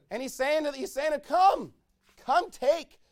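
loud, high preaching with shouted calls of come; full-force preaching. Acted. Preacher calling the church to come, loud and high. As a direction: cry it out to the whole congregation.

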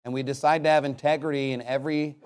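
closing prayer. Real, not acted. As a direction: lead the congregation in prayer, low and restrained.

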